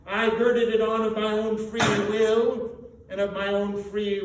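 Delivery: loud, heavy, mournful ghost; groaning ghost. A narrator's reading. Marley's ghost warning Scrooge, heavy and mournful. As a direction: drag out the weight of each word.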